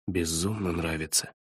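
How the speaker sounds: very soft, low whisper. A narrator's reading. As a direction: breathe it out quietly. Whispered continuation of his line.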